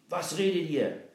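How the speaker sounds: loud, high suspicious shout. Acted. Captain shouts at the children whispering secretly, loud suspicious yell. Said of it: "Der Hauptmann schrie".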